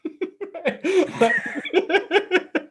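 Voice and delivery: real laugh breaking out high; high burst of laughter. Real, not acted. Telling about the son with the kitten, laughing. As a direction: laugh at the son's excuse.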